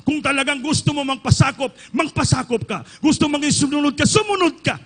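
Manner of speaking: loud, high-pitched preaching climax; full-force preaching. Real, not acted. Preacher exhorting the congregation at the climax, loud. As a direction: urge the church to submit, loud.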